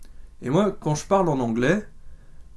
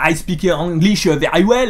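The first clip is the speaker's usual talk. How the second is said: slow, loud English with a heavy French accent; hammed-up French accent in English, slow and loud; thick French accent; 3 semitones higher, 7 dB louder, slower than usual. Shown as a demonstration. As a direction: overdo the French accent on every English word. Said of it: "je parle pas comme ça".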